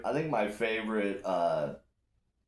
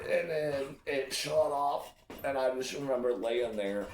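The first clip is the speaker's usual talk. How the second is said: ordinary talk, nothing marked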